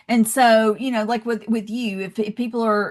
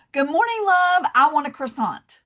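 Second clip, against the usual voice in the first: higher, sweeter good morning, love; friendly greeting; 6 semitones higher than usual. Retold or imitated. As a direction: add warmth with one extra word. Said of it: "I would go in and go".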